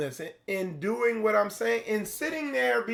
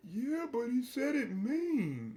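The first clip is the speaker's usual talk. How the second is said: whiny complainer; 6 semitones higher, 5 dB quieter, slower than usual. Retold or imitated.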